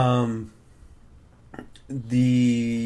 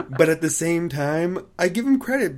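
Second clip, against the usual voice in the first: laughing; 6 semitones higher, 3 dB louder than usual. Real, not acted. Laughing after joke.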